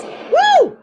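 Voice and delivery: ordinary delivery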